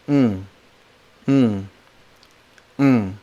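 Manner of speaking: "mm" as the "ng" hum of "sing"; NG consonant. Shown as a demonstration. Teacher models the N G consonant, nasal "ng". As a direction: hum "ng" at the back of the mouth. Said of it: "like the N G sound in sing".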